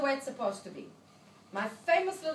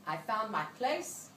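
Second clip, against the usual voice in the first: usual speaking voice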